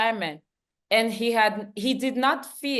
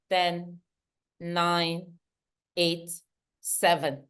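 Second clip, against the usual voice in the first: counting slowly, trailing lower; 5 semitones lower, 4 dB quieter, slower than usual. Shown as a demonstration. Speaker showing how to count down, slowly. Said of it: "demonstrates slow counting".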